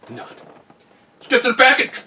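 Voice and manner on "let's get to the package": loud, high eager call; rally everyone to open it; excited shout. Real, not acted.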